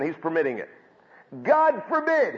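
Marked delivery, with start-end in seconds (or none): 1.4-2.4 "God forbid" much higher and louder, falling hard; emphatic denial. Retold or imitated. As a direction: cry out the denial. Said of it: "he says".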